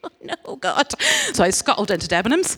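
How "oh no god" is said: laughing dismay. Retold or imitated. Retold dread at God's request.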